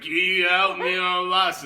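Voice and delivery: loud, high mock wail; desperate plea. Lost drunk begging two troopers for help, mock-desperate.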